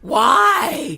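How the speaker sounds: loud blunt why; girlfriend asking. Retold or imitated. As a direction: toss back a puzzled why. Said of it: "she's like".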